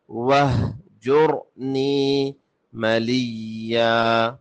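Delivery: slow, melodic tajweed chant with long held vowels; Quran recitation. Shown as a demonstration. Teacher recites the verses for learners, measured Quranic chant. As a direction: chant each phrase in sustained tones with full pauses.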